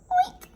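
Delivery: very high loud squeal oink; piglet oink. Acted. Narrator makes pig sound.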